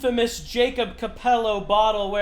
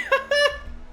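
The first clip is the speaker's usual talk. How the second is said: high-pitched laugh. Real, not acted. Laughs after near crash.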